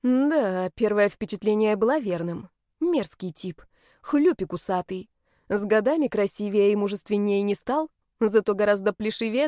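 usual speaking voice

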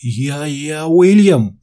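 high, slow frightened stammer. A narrator's reading. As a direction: stumble over your own name in fear. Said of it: "запинаясь".

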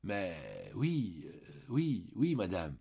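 halting, repeating oui with a hesitation; hesitant stammer. A narrator's reading. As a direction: stumble over the answer, unsure. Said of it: "bégayait".

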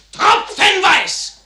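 a sworn oath bursting into a shout; passionate shout. Acted. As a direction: cry out the vow with everything. Vows his blood for Wallenstein.

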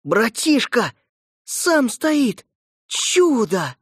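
put-on girl's delighted cries; overjoyed little sister exclaiming; joyful. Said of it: "ясный и чистый голос Коры".

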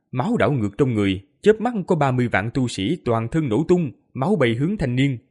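ordinary delivery, nothing marked

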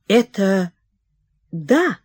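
ordinary delivery, nothing marked

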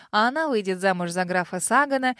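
ordinary delivery, nothing marked

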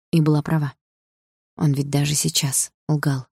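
ordinary delivery

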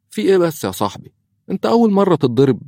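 high, quick mocking tease; teasing. A narrator's reading. Khalid teasing his sulking friend, high mocking voice. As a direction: poke fun at a sulking friend. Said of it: "ليتابع خالد ساخرا".

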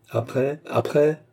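impatient repeated question, climbing in pitch; edgy, insistent prompting. A narrator's reading. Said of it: "insista sir Williams, qui commença à frémir de colère".

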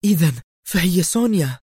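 sudden exclamation of discovery; exclaiming. A narrator's reading. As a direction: blurt out the name as it dawns on her. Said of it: "هتفت منى".

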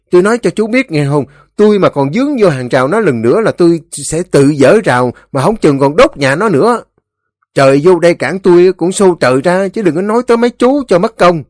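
loud, high, fast threatening declaration; defiant Ba Xị. A narrator's reading. Ba Xị warns the elders he will tear down the fence, defiant. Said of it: "Ba Xị tuyên bố như vậy".

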